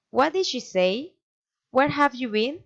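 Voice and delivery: questions climbing on SAY and BEEN; interested tone, pitch lifted at SAY and BEEN; friendly rising intonation. Shown as a demonstration. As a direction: swing up at the end of SAY and BEEN. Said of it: "rising intonation".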